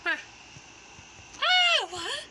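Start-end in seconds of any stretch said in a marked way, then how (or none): 1.4-2.2 high, loud startled cry; high loud startled what. Acted. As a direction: jump at the shock. Sudden surprise during rescue.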